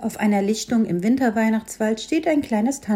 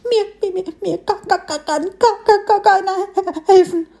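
high, stammering sob that swells louder; sobbing stutter; 10 semitones higher, 5 dB louder than usual. A narrator's reading. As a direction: choke out the words through sobs, much higher. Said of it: "schluchzte der Frischling".

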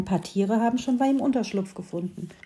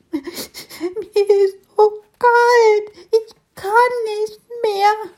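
much higher, slow tearful whimper; 13 semitones higher, 6 dB louder, much slower than usual. Acted. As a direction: wail it like a freezing little beetle. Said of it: "jammerte der Kleine".

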